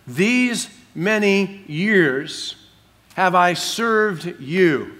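very slow, word-by-word quotation; emphatic quoting. Retold or imitated. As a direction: spell out the grievance one loud word at a time. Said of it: "in the tone of what he's saying".

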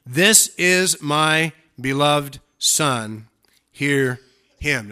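loud, very slow proclamation; voice of God. Acted. The Father speaks out of the cloud, solemnly proclaiming. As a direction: proclaim each word with weight and pauses.

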